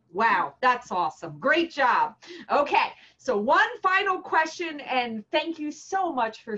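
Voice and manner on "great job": normal talking voice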